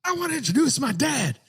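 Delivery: very high, strained mock wrestler promo; Braun Breaker. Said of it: "But dude, Braun Breaker".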